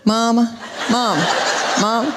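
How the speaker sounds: lightly voiced child calling on repeat; dazed little child. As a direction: drone "mama" over and over, evenly spaced. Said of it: "sitting up there in a daze".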